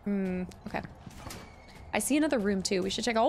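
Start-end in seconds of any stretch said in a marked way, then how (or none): none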